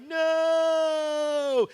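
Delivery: high loud No shout; hammed-up cry of defeat; villain's wail. Haman hears his punishment.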